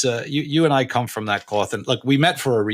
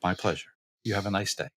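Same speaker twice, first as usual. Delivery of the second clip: soft courteous reply; 4 semitones lower, 9 dB quieter than usual. Retold or imitated. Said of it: "I'm like".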